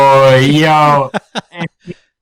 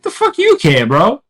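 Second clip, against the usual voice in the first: mock indignant; 6 semitones higher than usual. Mocking skeptical prick.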